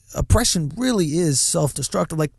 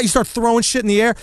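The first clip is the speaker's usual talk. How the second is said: usual speaking voice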